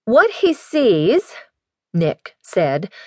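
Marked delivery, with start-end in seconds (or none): 0.0-1.3 high, slow, pointed reply. A narrator's reading. Daughter cuts in on the question about herself, high and slow.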